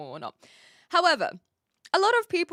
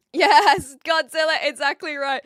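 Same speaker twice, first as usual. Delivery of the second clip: excited shout; 6 semitones higher, 10 dB louder than usual. Real, not acted. Cheering a chat comment.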